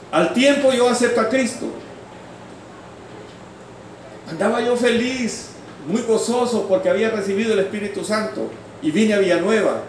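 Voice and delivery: high, projected testimony voice; fervent preaching. Acted. Preacher testifying to the congregation about his conversion, fervent preaching.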